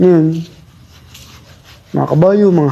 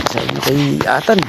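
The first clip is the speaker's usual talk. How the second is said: usual speaking voice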